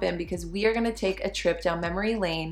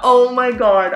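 excited; 5 semitones higher, 12 dB louder, slower than usual. Real, not acted. Reacting to old journal entry.

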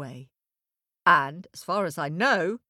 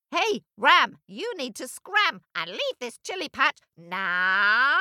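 donkey voice; 7 semitones higher than usual. Acted. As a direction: bray it at the ram like a bossy donkey. Donkey tells Ram to leave.

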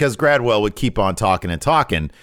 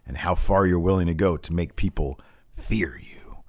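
hushed menace; 3 semitones lower, 5 dB quieter than usual. Retold or imitated. Hushed, ominous end of retold promo.

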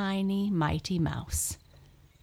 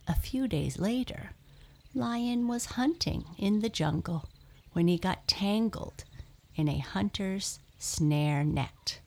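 usual speaking voice

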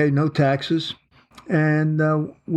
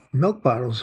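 ordinary talk, nothing marked

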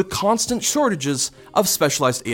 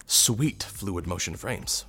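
whisper; 4 semitones lower, 7 dB quieter, much slower than usual. Acted. Savoring a sip of frames.

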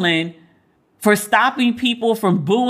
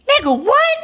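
very high, louder squeal of disbelief; a stunned, squealing what; shocked shriek; 4 dB louder than usual. Real, not acted. Shocked by friend's admission.

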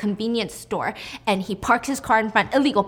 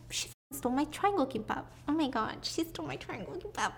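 higher and softer, breaking into a laugh; mock dazed lament; 5 semitones higher, 9 dB quieter than usual. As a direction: swoon over the stolen snack, giggling. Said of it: "Be like".

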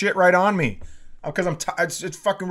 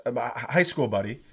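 ordinary talk, nothing marked

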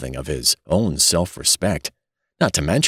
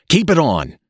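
much higher, louder urgent repeat; hurried, more urgent; 10 semitones higher, 4 dB louder than usual. A narrator's reading. Father urgently stopping his son undressing, higher and louder. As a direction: push harder the second time. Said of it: "said Mister Button hurriedly".

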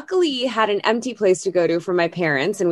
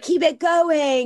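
slow, higher devilish coaxing; devil on shoulder; 7 semitones higher, slower than usual. Retold or imitated. Inner devil urging her to keep yelling, slow sly coaxing. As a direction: tempt her slowly like a little devil. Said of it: "the devil was like".

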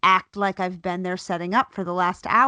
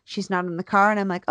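normal talking voice

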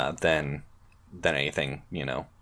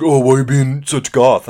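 mocking kid voice; 6 semitones higher, 9 dB louder than usual. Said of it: "everybody's like".

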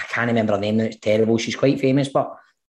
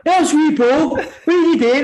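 higher, louder mock shoppers calling out; passersby calling; 13 semitones higher, 3 dB louder than usual. Shoppers recognising the soap actor in the supermarket, calling out. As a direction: hail him like a fan in the aisle. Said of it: "People are going".

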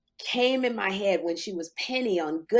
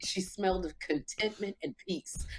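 hushed dreamy; 6 dB quieter than usual. Acted. Dreamy answer about the star.

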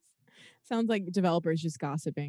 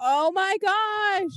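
high, loud, slow oh my gosh; delighted squeal; 12 semitones higher, 6 dB louder, much slower than usual. Real, not acted. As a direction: squeal with delight at something cute. Delighted by a website logo.

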